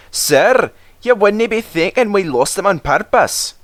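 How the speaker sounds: high Scottish engine voices; Scottish twins. A narrator's reading. Twins answer the Fat Controller in a Scottish brogue.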